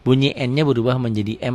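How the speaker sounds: normal talking voice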